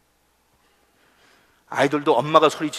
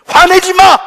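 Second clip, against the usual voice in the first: loud, high bellow; angry outburst; 17 dB louder, slower than usual. Acted. Husband blowing up at family in passing, angry outburst. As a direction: explode suddenly with the order. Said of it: "화를 버럭 내면서 한마디".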